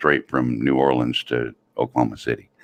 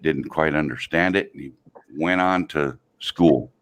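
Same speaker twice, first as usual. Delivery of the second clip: normal talking voice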